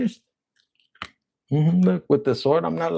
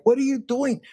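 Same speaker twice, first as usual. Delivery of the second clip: accusing; 8 semitones higher than usual. Acted. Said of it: "you could have been the one like".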